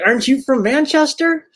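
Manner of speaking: high-pitched confused question; rising bewildered ask. Retold or imitated. Man asking a stranger if she's from Manchester, high and confused. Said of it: "I was like".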